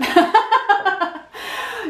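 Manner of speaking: high giggling laugh; embarrassed laugh. Real, not acted. Laughs at own failed pose.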